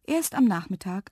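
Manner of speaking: ordinary delivery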